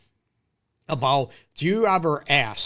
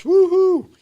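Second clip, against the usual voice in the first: loud, high sports cheer; 9 dB louder than usual. Real, not acted. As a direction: whoop for the home team. Cheering for Detroit teams.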